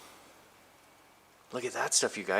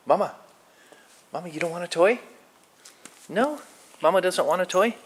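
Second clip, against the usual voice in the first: talking to a cat; 7 semitones higher than usual. Real, not acted. Offering cat Mama a toy.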